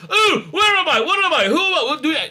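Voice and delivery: high, loud, breathless confused babble with a laugh; David Tennant impression. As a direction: overplay a dazed, flustered hero. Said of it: "this full-on Tennant impersonation".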